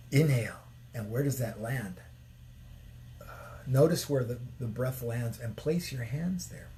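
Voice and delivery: soft, low meditation guidance; guided meditation. Acted. Guiding viewers through a morning exercise, soft meditation voice. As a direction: coax them gently into the breath.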